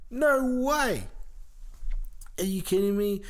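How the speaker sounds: high, incredulous "no way"; mock disbelief. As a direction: act shocked.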